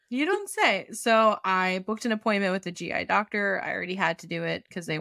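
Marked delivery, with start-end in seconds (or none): 0.1-0.9 higher, mocking repeat of the line; sarcastic parroting echo. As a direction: toss it back with a sneer. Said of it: "repeats listener's line, mocking".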